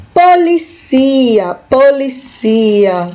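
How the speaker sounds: "Policia" in Spanish, stress on the "i": po-li-CI-a, said slowly; Spanish pronunciation. Shown as a demonstration. Teacher drilling students, slow Spanish "policia". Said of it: "o som diferente ... o acento no local diferente".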